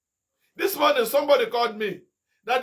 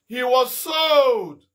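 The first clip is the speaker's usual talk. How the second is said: louder, drawn-out he was sold; emphatic slow; 4 semitones higher, 5 dB louder, much slower than usual. Acted. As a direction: lean on sold slowly. Preacher stressing Joseph's fate.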